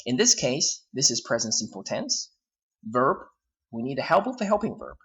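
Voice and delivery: usual speaking voice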